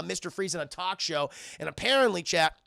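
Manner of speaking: ordinary delivery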